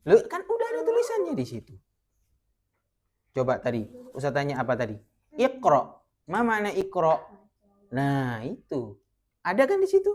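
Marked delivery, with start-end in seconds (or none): 0.1-1.8 high, exclaiming loh; surprised it's right there in the text. Real, not acted. Teacher exclaiming in surprise to a confused student. Student missed the written answer. 9.5-10.1 high, exclaiming loh; surprised it's right there in the text. Real, not acted. Teacher exclaiming in surprise to a confused student.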